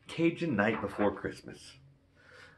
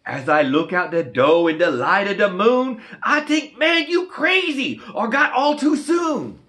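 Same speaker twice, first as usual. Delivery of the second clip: put-on higher, louder Cajun storyteller voice for the kids; Cajun story reading; 11 semitones higher, 9 dB louder than usual. Reading a Cajun Christmas book to kids, higher, louder Cajun storyteller voice. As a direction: spin the Cajun tale with lively bayou flair.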